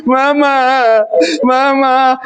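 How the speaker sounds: high, acted wail for mum; hammed-up tearful call; mock crying. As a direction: wail like a daughter coming home in tears. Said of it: "anaknya pulang nangis".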